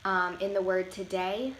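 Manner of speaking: ordinary talk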